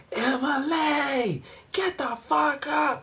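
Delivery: very high, drawn-out screech; mock yelling. Neighbor mom waking her daughter, drawn-out mock yell. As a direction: imitate a mom screeching a wake-up, slow and high. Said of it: "That bitch just yell and yell and yell".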